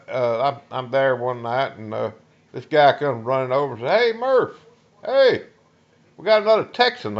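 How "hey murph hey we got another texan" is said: calling out. Retold or imitated. Buddy calling out to him.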